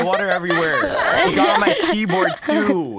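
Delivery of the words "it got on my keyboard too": cracking up while complaining; laughing. Real, not acted. Spat water on keyboard.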